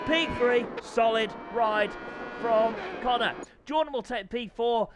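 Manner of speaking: fast, keyed-up race commentary; live play-by-play. Real, not acted. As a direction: call the race as it unfolds.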